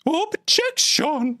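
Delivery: very high, drawn-out squeaky objection; badly faked falsetto cry, stretched slowly; even worse Cubics impression. Streamer retrying a terrible impression, even worse, hammed-up falsetto. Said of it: "that's even worse".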